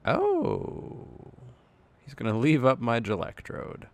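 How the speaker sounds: ordinary talk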